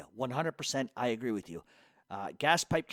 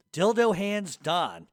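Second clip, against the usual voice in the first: slow disgusted read; 4 semitones higher, 7 dB louder, much slower than usual. Shown as a demonstration. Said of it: "getting progressively more disgusting".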